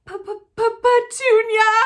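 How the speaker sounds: slow, high, stuttering panic; stammering gasp. Acted. Husband stammering his wife's name in horror, high and slow. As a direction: choke out the name in shock. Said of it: "he gasped".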